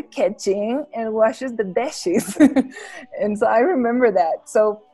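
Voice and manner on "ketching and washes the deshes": mock Scottish vowels, "ketching" and "deshes"; imitated Scottish accent. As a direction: imitate a Scottish friend's odd vowels, slowly. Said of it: "a very weird accent".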